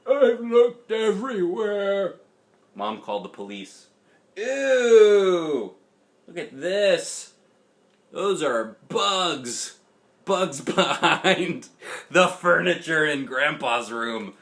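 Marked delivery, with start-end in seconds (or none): 0.0-2.2 put-on high, loud, slow old man lament; tearful grandpa, much higher and louder. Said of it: "with tears in his eyes". 4.3-14.4 loud, slow grossed-out ew; disgusted. Real, not acted. Reader voices disgust at picture.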